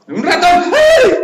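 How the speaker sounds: frightened squeal at a mouse; voice of fear. Shown as a demonstration. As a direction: shriek as if a mouse ran by. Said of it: "A ver, denme una voz de miedo".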